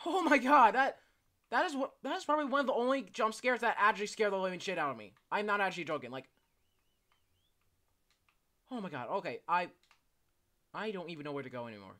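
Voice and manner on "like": ordinary talk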